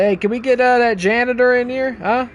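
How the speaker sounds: hammed-up shout for help; mock calling out. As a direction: call across the room jokingly. Calls out for janitor.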